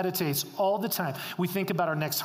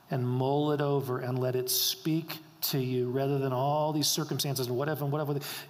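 usual speaking voice

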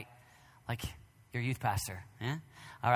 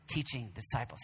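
usual speaking voice

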